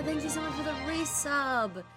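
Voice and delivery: ordinary delivery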